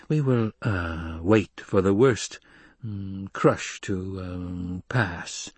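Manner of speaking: slow, drawn-out words with hums; hesitant, hemming. Acted. Count gives hesitant, hemming order.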